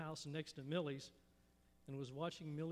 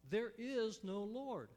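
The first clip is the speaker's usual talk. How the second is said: yelled back; 5 semitones higher, 4 dB louder, much slower than usual. Acted. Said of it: "the man yelled back".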